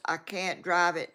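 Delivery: normal talking voice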